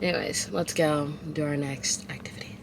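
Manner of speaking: soft whispered aside. Real, not acted. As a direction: breathe it out, barely voiced. Signing off the segment.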